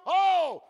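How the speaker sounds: loud, high "oh" of alarm; sudden hammed-up cry of fright; startled donkey cry. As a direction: yelp like a spooked donkey.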